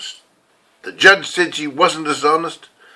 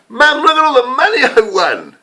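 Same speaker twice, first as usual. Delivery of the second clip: put-on high, bright-eyed kid showing off his winnings; excited child; 10 semitones higher, 4 dB louder than usual. Young [name] showing Mum his winnings, excited child. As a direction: crow it like a thrilled little boy. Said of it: "I said".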